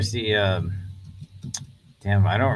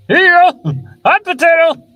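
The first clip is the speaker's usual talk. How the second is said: squeaky mock hot potato; 15 dB louder than usual. Mocking the toss in a voice.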